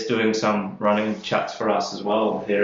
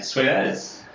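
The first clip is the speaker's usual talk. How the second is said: Kiwi twang on sweet as; broad kiwi accent; 4 semitones higher than usual. Real, not acted. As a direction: say it like a proper New Zealander. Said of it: "You get more Kiwi every time you say that".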